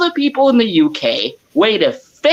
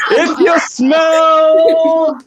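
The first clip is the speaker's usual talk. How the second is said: slow, loud, booming wrestler catchphrase, higher; The Rock; 8 semitones higher, 4 dB louder, much slower than usual. Pretend. Host answering cohost's request, slow loud The Rock voice. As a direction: boom it out like a wrestling promo. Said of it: "why can I imagine you saying that in The Rock's voice".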